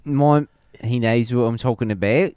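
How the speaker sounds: mock Australian, laughing through it; Hannah Gadsby impression. Shown as a demonstration. Aussie comedian bit for friends, mock accent. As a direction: try a Hannah Gadsby voice, cracking up. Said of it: "Do it, do it as, as Hannah".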